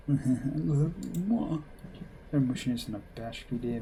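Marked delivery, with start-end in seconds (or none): 0.1-1.6 put-on smitten girlfriend. Girlfriend kisses boyfriend.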